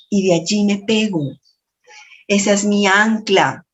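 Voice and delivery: ordinary talk, nothing marked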